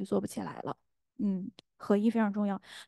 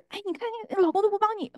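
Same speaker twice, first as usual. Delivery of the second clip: higher, faster needling whisper of complaint; tempting inner voice; 10 semitones higher, faster than usual. Retold or imitated. An inner voice stirring a wife's resentment, high and rushed. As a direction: egg her on against her husband, high and quick. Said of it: "然后就是".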